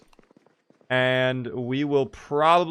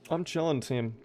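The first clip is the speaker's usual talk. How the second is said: soft repeat; 8 dB quieter than usual. Real, not acted. Streamer reassures his team again, softly and trailing. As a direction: murmur "I'm chilling, team" softer.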